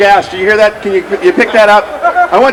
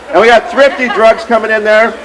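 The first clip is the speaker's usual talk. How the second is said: ordinary talk, nothing marked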